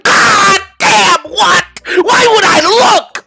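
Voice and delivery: anguished yell. Real, not acted. Shocked by video.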